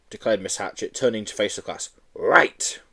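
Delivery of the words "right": a teacher's sharp high call; stern teacher. Acted. Teacher calling her class to order, sharp. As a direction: snap the class to attention.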